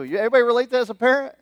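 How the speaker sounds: normal talking voice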